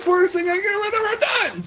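ordinary talk, nothing marked

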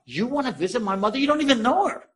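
incredulous, pitch climbing sharply to the end; retold astonishment. Son to the stranger offering to visit his mother, astonished. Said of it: "The guy almost fell out of the car".

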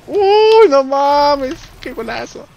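screaming wildly at a great goal; high shout of goal joy; breakneck goal commentary. Real, not acted. Goal celebration.

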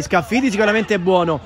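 ordinary delivery

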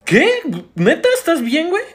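high, loud incredulous question; alarmed disbelief. Acted. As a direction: react in sudden comic alarm. Acted alarm at friend's claim.

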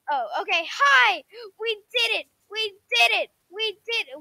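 high-pitched disbelieving joy; giddy, can't believe it; excited. Real, not acted. Unexpected victory in game.

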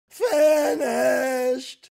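high, loud wobbly shout; a triumphant Ed Wynn cry. Retold or imitated. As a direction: shriek it with delighted old-comic glee. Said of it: "in his best Ed Wynn voice".

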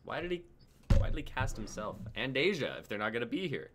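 usual speaking voice

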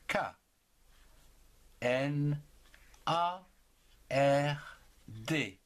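ordinary talk

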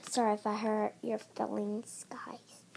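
soft whispered sorry to the guys; whispered apology. Pretend. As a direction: breathe the apology out quietly.

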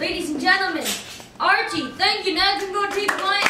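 loud showman introduction; announcer voice. Acted. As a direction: present the act with flair. Announcing to the audience.

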